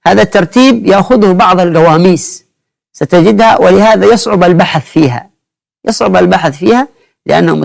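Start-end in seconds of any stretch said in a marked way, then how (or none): none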